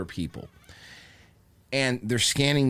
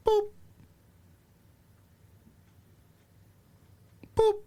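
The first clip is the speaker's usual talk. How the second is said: short high "boop"; imitated scanner beep. Cashier scanning items slowly, imitated beeps. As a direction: mimic a checkout beep, one at a time.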